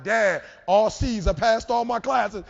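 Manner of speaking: loud, drawn-out proud announcement; jubilant son. Retold or imitated. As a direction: crow slowly to Dad, hugely pleased. Son proudly announcing his grades.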